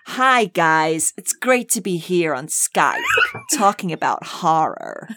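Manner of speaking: attempted American drawl; Jerry Hall-like Texas drawl. Shown as a demonstration. Guest showing off accents, American drawl attempt. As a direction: put on a Texan-style American accent. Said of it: "trying to be Jerry Hall".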